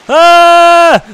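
delighted high scream into laughter; shriek, then laugh it off; excited scream. Real, not acted. Tube flies off slide.

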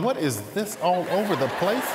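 puzzled question through a laugh; chuckling mock-confusion; laughing passengers' quote.

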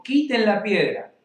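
loud, high command to roll the stone away; preacher voicing Jesus giving a firm order. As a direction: call out the order with authority. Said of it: "Orden de Jesús".

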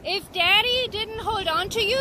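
ordinary talk

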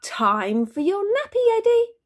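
sing-song, high rising cheery call. A narrator's reading. Dad calls baby for a nappy change, all cheery. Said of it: "I say all cheery".

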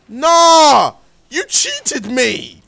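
loud, high cry, then drawn-out accusation; outraged protest. Real, not acted. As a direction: protest loudly at being robbed. Outraged at the game.

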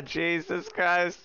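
ordinary delivery

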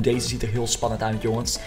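ordinary delivery

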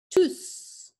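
tous said as "too" with no final S; tous, silent S; pronounced tous. Shown as a demonstration. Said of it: "Did you notice I said tous?".

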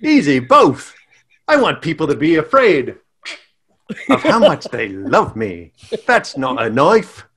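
put-on playful, broad Australian accent; Australian Cupid. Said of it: "Australian Cupid".

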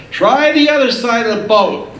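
high, loud call across the water; calling from the shore. Retold or imitated. Stranger on the shore calling to the fishermen, loud and high. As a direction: call out to the boat.

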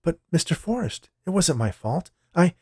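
high, pleading woman's voice; pleading protest. Acted. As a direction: protest meekly until cut off. Fired woman protests, gets cut off.

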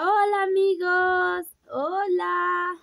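put-on high, slow, sing-song hello for the baby to copy; mother showing her baby how to say hello, cooing; baby talk greeting. Shown as a demonstration. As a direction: model the greeting sweetly for the baby.

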